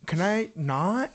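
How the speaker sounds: put-on slow, drawn-out Valley Girl whine. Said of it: "you talk like this".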